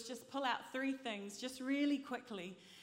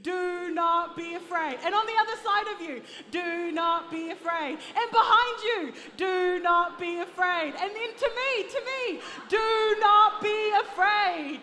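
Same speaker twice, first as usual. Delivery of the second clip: rally the congregation, louder each round; rallying shout; 7 semitones higher, 12 dB louder than usual. Real, not acted. Rallying congregation, building in volume.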